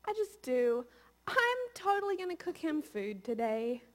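imitating her own happy devotion; light, sweet retelling of loving days; doting wife. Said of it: "there are days when I'm like".

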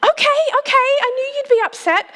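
high, fast mock-soothing mum voice; mum placating. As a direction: imitate mum brushing off the impatience. Mum answering daughter's impatience.

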